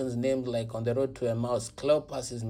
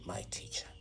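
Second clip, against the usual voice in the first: whisper; 10 dB quieter than usual. Acted. Mary's words whispered in the scene.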